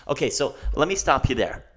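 ordinary delivery

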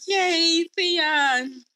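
high, drawn-out little cheer; mock cheering. Viewers cheering as Theon keeps getting up, playful cheer.

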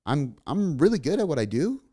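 rising question tune on a statement; uptalk demo. Shown as a demonstration. Coach imitating people who sound unsure, with a rising question tone. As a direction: say it like you're unsure, ending up. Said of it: "They talk like they're kind of asking a question".

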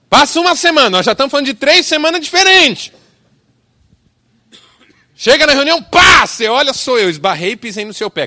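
fiery preacher yelling the punchline; full shout. A narrator's reading. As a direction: hammer it home at the top of your voice. Fourth bump, preacher climaxes.

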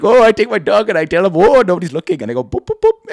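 playful put-on accent, telling a sneaky tale; hammed-up imitation of a coworker; Hassan. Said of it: "that's generally what he sounded like".

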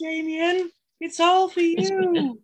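high, slow and eerie, like the possessed nanny; Omen nanny quote. Retold or imitated. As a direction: lift it up high and draw it out, creepily devoted. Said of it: "Really strong Omen vibes".

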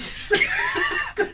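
shrill burst of laughter; high squealing laugh. Real, not acted. Friends laughing hard.

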